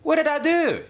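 very high, startled defensive squeak; high-pitched, flustered denial; stammered protest. Acted. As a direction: protest as if wrongly accused. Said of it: "he stammered".

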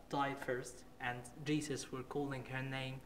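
normal talking voice

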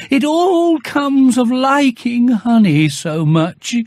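very slow, high, rueful bear; Pooh musing mid-fall. Acted. Bear resigned as he falls, slow and high. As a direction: draw it out like a bear resigned to falling.

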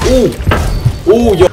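high panicked yelps; panicking gamer. Real, not acted. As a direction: yelp in sudden panic.

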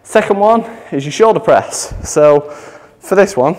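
breathless, rushing the words; out of breath. Real, not acted. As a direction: talk while still out of breath. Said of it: "I'm getting myself all out of breath".

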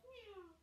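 small, high, soft meow; cat meow. Shown as a demonstration. Yoga teacher leading cat pose, cat meow. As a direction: squeak out a soft, falling kitten meow.

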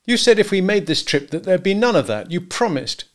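high, loud, aggrieved protest; aggrieved husband. A narrator's reading. Husband to wife about their trip, aggrieved. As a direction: hold her to the promise. Husband repeats his accusation.

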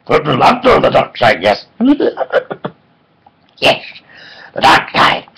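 mock Yoda, partly whispered; Yoda impression. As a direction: croak it like the little Jedi master. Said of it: "who you may know as Yoda".

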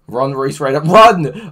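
shouting a mock warning to a viewer; mock urgent yell.

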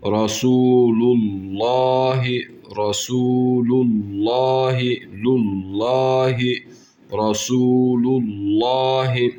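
Rasulullahi slow, the lam vowel drawn out; stretched recitation. Shown as a demonstration. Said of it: "Lamı uzatıyoruz". (Rasulullahi lamı uzatarak)

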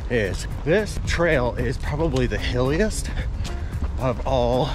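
normal talking voice

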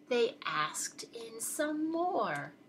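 slow, soft, drawn-out reveal; hushed suspense. A narrator's reading. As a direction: drop to a half-whisper and stretch it out.

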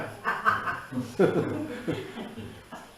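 breathless unvoiced laugh after the skit. Real, not acted. Monk laughing at the crying-mother story, unvoiced laugh. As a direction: let out a silent wheezing laugh.

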